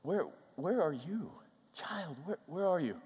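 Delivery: soft, halting, intimate call; gently seek out a lost child; hushed fatherly care; tender, caring question. Acted. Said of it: "The care".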